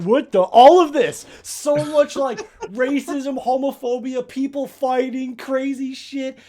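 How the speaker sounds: loud, high incredulous recap; exaggerated exasperated astonishment; mock bewilderment. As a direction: pile it up in amused disbelief, slowing at the end. Mimicking owner's reaction to chaos.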